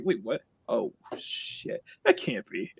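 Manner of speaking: very soft, re-enacted surprise; retold hushed disbelief. Streamer retelling noticing the PayPal, hushed disbelief. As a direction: drop to near-whisper, re-enacting disbelief.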